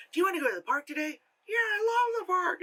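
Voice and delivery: fast, high squeaky puppet voices; ventriloquist dummy voices. Retold or imitated. Vlogger mocking the girl's ventriloquism with squeaky puppet voices. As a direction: rattle it off like a ventriloquist act. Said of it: "But instead it's like".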